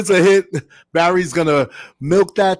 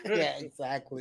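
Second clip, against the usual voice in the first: usual speaking voice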